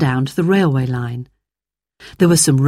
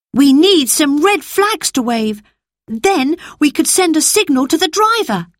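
put-on much higher boy's voice, grave; a boy warning of disaster; worried boy Peter; 10 semitones higher than usual. Narrator voices Peter planning.

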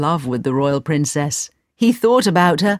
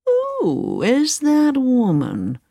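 lightly voiced curious Sultan; 7 semitones higher, slower than usual.

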